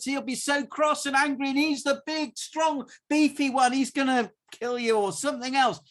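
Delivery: high, loud urgent call to run; urgent warning. Acted. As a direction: yell at him to flee now. Mother warns Jacob to flee.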